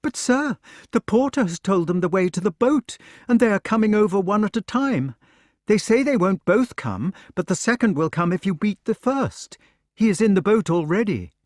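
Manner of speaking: a hint of a flustered servant girl hurrying with news; anxious maid. Maid Gwyneth pleads with Lancelot.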